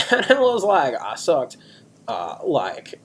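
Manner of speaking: bragging bro telling a wild story; Chaz. Acted. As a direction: stretch the key words like an excited party bro. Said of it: "we're doing characters this week".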